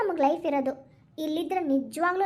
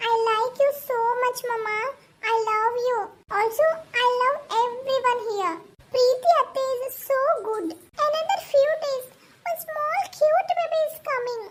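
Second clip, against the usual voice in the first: put-on high, slow child chattering happily; a small girl gushing about the new baby; sweet child voice, higher as she gets excited; excited little girl; 7 semitones higher, much slower than usual. Child talks to parents.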